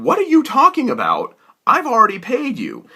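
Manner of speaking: can't believe what he's hearing; incredulous retort. Retold or imitated. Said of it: "And I said".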